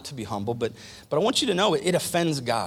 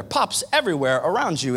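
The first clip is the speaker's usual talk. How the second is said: ordinary delivery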